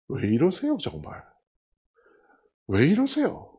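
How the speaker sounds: retold protest to God, held back from anger; exasperated prayer. Director asks God why this happens, exasperated pleading. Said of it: "화를 내지 않고 자리에서 기도했어요".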